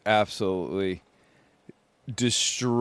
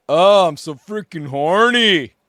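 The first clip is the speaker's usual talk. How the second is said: louder, higher mock announcement; hammed-up imitation of a movie character blurting it out; quoting a movie character; 7 semitones higher, 7 dB louder than usual. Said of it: "He's like".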